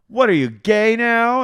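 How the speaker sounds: high, loud, slow mock shriek; shocked question. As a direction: blurt it out scandalized. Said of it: "And she goes".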